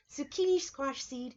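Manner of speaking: usual speaking voice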